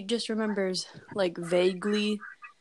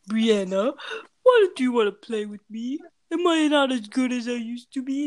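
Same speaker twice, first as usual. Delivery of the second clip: loud creepy clown doll, rising higher; Fido's voice; 4 semitones higher, 5 dB louder than usual. Acted. Doll chases girl wanting to play, loud creepy voice. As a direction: plead loudly as a haunted toy. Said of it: "that's the voice that she used to give Fido".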